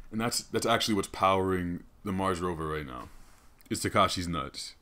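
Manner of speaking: usual speaking voice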